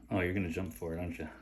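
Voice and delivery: normal talking voice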